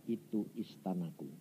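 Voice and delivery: low, hushed voice of God; calm divine answer. Retold or imitated. God telling the preacher whose palace it is, low and hushed. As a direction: say it low and very softly.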